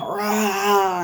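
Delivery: imitated bear roar. Bear stands up over them, imitated roar.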